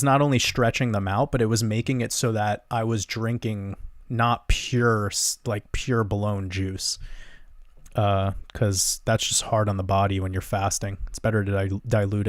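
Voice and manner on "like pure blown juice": ordinary talk, nothing marked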